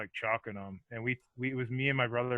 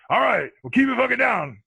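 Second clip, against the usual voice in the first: loud, high warning from dad; gruff dad; 11 semitones higher, 7 dB louder than usual. Retold or imitated. Dad telling sons to keep it down, loud high gruff warning. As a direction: bark it at your rowdy grown sons. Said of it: "He goes".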